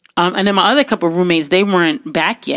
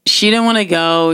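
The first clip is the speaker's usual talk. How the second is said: ordinary talk, nothing marked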